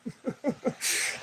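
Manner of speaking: quiet, breathy little laugh; soft chuckle. Real, not acted. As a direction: chuckle softly to yourself. Amused by his own remark.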